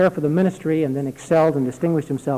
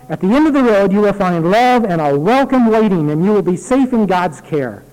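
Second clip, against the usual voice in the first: put-on earnest reading of an old letter; letter read aloud; 2 semitones higher, 4 dB louder than usual. Pastor reading a dying aunt's letter aloud, earnest reading. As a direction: read it out heartfelt to the congregation.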